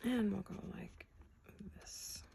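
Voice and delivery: soft unvoiced whisper. Real, not acted. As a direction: whisper it while concentrating. Whispered while working.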